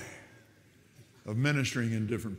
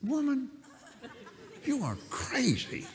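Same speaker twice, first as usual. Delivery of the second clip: high-pitched, incredulous retort, acted out; exasperated disbelief; 5 dB quieter than usual. Killer to the captive who says she loves him, exasperated. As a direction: draw it out high, as a retold protest.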